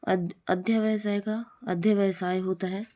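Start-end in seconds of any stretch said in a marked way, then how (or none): none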